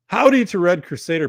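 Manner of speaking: bright, loud call-out greeting; hearty loud howdy to a fan. Real, not acted. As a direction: shout a welcome to a viewer. Greeting a chat viewer.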